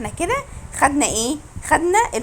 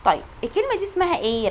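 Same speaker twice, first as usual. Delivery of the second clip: usual speaking voice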